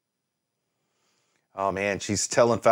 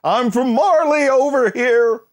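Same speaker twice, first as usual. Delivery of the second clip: higher, louder, slow mocking shout; mock yell; 13 semitones higher, 5 dB louder, slower than usual. Vlogger mocking spies who yelled their origin, mock shout. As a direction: ham up a clueless spy yelling. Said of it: "yell at the top of their lungs".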